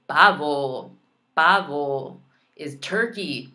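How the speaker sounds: ordinary talk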